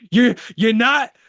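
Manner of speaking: loud, high mock meltdown; imitating angry outburst. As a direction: act out a furious little rant, haltingly at first. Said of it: "and he gets really mad".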